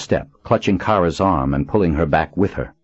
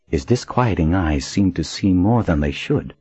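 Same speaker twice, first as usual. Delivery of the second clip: ordinary delivery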